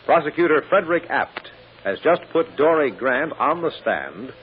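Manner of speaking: ordinary talk, nothing marked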